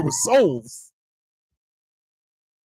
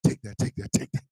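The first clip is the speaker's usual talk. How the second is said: mock gleeful attacker; 12 semitones lower, 8 dB quieter than usual. Acting out the snake thrower.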